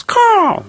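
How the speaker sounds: a piercing, very high crow caw; a bird's loud caw, very high; crow caw imitation. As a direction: squawk like a passing bird. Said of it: "like that".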